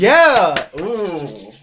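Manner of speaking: loud, high burst of real excitement; excited. Real, not acted. Player whose guess about the mystery monster lands, thrilled shout. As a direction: cheer out loud at a right guess. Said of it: "Makes me excited".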